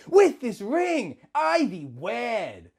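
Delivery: high, slow, passionate vow. Acted. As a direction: savor each word, slowly. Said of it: "with passion".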